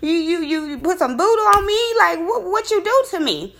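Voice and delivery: high, loud, disbelieving; retold shocked outburst, pitched high. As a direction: throw the questions at him in alarm. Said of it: "I'm like".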